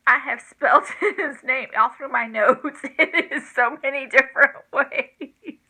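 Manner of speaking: laughing through the words; giggling at her own mistake. Real, not acted. Grandma confessing her misspellings to the child, laughing.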